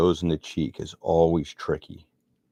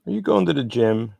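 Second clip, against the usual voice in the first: higher, louder imitation of a daughter asking; imitating daughter; 8 semitones higher, 5 dB louder than usual. Daughter phones dad on the way home, higher imitated voice. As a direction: voice a daughter's question, pitched up.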